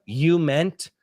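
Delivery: ordinary talk, nothing marked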